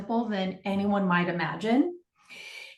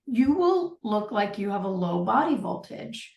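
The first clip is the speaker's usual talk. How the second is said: ordinary talk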